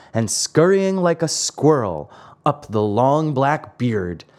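ordinary delivery, nothing marked

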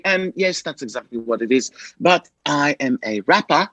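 imitated daughter's mock British accent. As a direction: put on a kid's British alter ego. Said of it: "started talking in a British accent".

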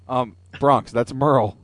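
ordinary talk

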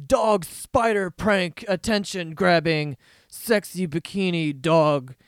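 loud, slow hyped headline voice; mock video title, drawn out and loud; clickbait title read. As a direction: announce it like a cheesy video title.

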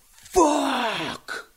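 put-on high drawn-out yell of a drunk; retold drunk yell. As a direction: bellow it like a drunk in the street. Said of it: "top of his lungs".

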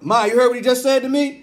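loud high whiny tattle; mock tattling uncle. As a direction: call out to grandma like a tattletale.

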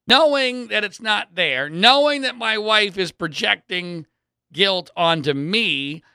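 usual speaking voice

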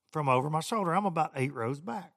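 ordinary talk, nothing marked

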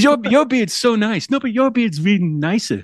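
high gushing mock compliment; gushing bromance. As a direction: gush over his beard like a fan.

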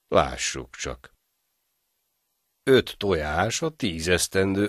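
slow, drawn-out calculation; slow reckoning. Acted. Greedy innkeeper tallies the egg debt, slow and deliberate. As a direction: count it up slowly, savouring each step.

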